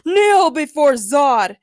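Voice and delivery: loud, slow, drawn-out command; villain's scream. A narrator's reading. Zod bursting through the door, screaming his demand. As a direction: bellow it as a commanding supervillain. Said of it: "General Zod screamed".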